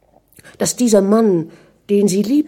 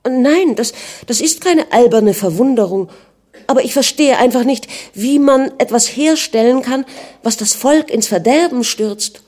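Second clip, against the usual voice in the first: higher and louder, quick upset pleading; agitated protest; 6 semitones higher, 3 dB louder than usual. A narrator's reading. Anna objecting to her husband's vodka plan, agitated. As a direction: push back, flustered and earnest.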